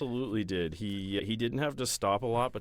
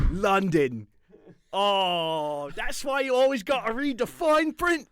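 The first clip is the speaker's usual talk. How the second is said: louder, high fake British voice; mock British accent; 10 semitones higher, 5 dB louder, slower than usual. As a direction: switch back into the silly Londoner. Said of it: "talk like that the rest of the episode".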